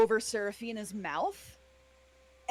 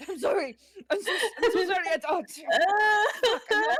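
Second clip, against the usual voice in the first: high, laughing panicked apology; louder, breathless stammering sorry between laughs; frantic apology; 8 semitones higher, 6 dB louder than usual. Acted. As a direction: babble sorry in a rising panic, close to tears. Ellery panics after hitting Seraphina.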